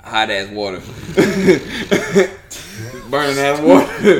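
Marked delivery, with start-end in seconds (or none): 0.8-4.2 laughing through the words; cracking up at the confession. Real, not acted. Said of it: "You stupid".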